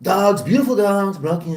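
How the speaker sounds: amateur impersonation, fading at the end; Donald Trump. Acted. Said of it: "He has a great Trump impression".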